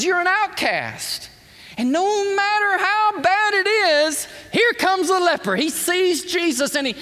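high, loud preacher's build; fervent pulpit delivery; impassioned preaching. Acted. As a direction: preach it out to the crowd at full force. Preaching the leper's plight.

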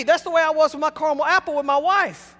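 usual speaking voice

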